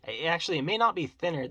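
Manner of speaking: ordinary talk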